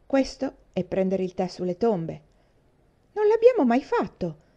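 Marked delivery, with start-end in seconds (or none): 3.1-4.4 much higher, louder protest; high, loud child's protest at the accusation; indignant outcry. A narrator's reading. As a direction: cry out in shocked denial. Children deny tea on graves.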